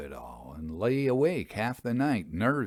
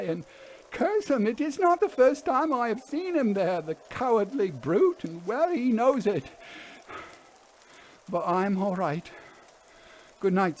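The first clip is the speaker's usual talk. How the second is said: higher voice of an uneasy railwayman; haunted engine driver; 10 semitones higher than usual. Acted. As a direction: play the driver, set apart from the narrator, pitched up. Fern's warning speech.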